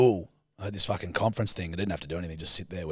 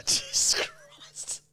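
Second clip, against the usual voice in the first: whispered; 5 dB quieter, much slower than usual. Real, not acted. Reacting in disbelief.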